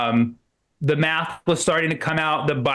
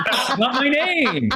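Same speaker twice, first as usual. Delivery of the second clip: laughing protest; 9 semitones higher, slower than usual. Pretend. Correcting the host, laughing.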